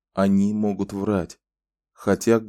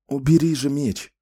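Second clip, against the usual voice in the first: sharp, higher, cross woman's voice; angry woman; 6 semitones higher than usual. A narrator's reading. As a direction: scold him for scaring the child. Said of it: "сердито сказала женщина". (сердитый женский голос)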